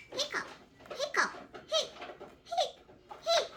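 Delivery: high, quick hiccup sounds. Acted. Little Pig caught out by his siblings, high hiccups. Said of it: "Little Pig gets the hiccups".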